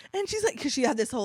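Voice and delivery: normal talking voice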